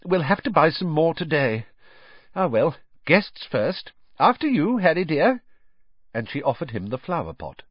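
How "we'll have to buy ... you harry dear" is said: a hint of a kindly, fussing mum; lightly voiced warm mother; motherly voice. Voices Mrs Weasley.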